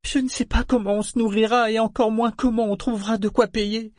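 high-pitched, tear-filled pleading; tearful. A narrator's reading. Malta tells Serwin of the captured ship, tearfully. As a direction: plead high, voice full of tears. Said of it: "d'une voix remplie de vraies larmes".

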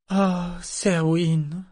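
high, slow, pained sigh of his name; sorrowful murmur. A narrator's reading. Malta answers Serwin's fear of lost hope, sorrowful murmur. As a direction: draw it out high with grief. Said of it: "murmura-t-elle avec chagrin".

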